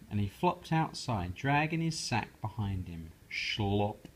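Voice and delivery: ordinary delivery, nothing marked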